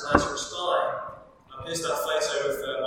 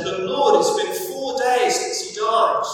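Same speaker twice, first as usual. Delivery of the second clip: louder and higher, pleading objection; emphatic protest; 7 semitones higher, 5 dB louder than usual. Acted. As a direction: push back urgently as Martha. Martha protests in read passage.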